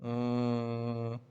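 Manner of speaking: normal talking voice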